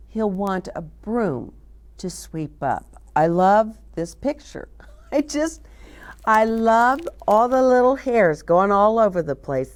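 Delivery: ordinary delivery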